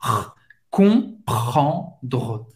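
"R", then "Comprendre", scraped in the throat. Shown as a demonstration. Teacher demonstrating the French R, "Comprendre" with a throat-scraped R. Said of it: "arranhar essa garganta para fazer esse R".

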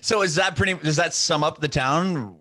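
asking through a laugh. Real, not acted. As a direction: giggle through the question.

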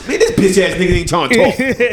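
high-pitched imitated griping; mock complaining. Coworker griping that he won't talk, mock complaint.

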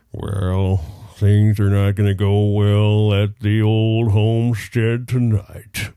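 slow, loud cartoon voice; cartoon anteater voice. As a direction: ham up a dejected cartoon anteater. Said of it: "give me a cartoon character voice".

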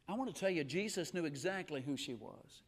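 ordinary talk, nothing marked

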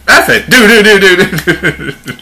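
high, loud rat-a-tat; hammed-up shooting noises; 1 high loud mock gunfire. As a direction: blast away with the do's. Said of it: "He's like".

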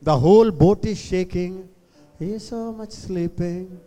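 normal talking voice

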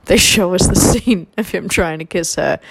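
normal talking voice

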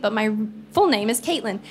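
ordinary delivery

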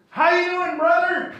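booming, high welcome to a stranger; loud eager greeting. Retold or imitated. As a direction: greet him like a long-lost brother, loud and high. Older man greets them, hand out.

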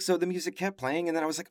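usual speaking voice

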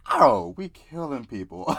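high, loud retold shock; shocked reaction. Dreamer reacting to a sudden shooting at the table, shocked. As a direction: exclaim it high, drawing out the words.

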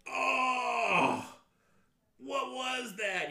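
loud, high, slow dazed shock. Acted. GM voicing the stunned guard, loud dazed shock. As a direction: reel from the blow, stunned. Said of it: "he's like".

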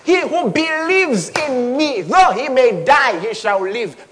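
slow preaching. Acted.

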